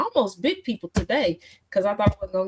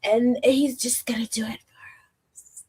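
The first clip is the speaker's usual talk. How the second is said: put-on louder then whispered valley girl; 2 semitones higher, 3 dB louder than usual.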